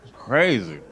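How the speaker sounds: normal talking voice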